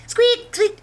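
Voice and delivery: very high, loud mouse squeak; imitated squeak. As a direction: mimic the odd little noise. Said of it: "What is it, like".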